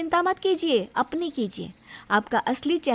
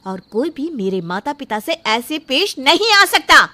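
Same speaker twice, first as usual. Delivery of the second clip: building louder and higher to a shout; furious shout; 3 semitones higher, 8 dB louder than usual. Acted. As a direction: let the anger swell line by line. Bride shouts at her fiancé. (मंगेतर पर बढ़ते गुस्से में चीखती दुल्हन)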